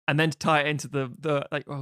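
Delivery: usual speaking voice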